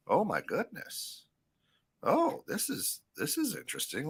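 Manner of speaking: high, soft voice of a curious bystander; acted-out hushed fascination; intrigued foreign onlooker. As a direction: marvel quietly from afar, pitch swooping up. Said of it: "Seeing somebody who looks like the rest of the world going".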